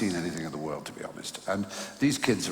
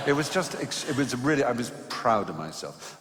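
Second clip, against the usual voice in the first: usual speaking voice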